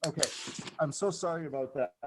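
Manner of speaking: normal talking voice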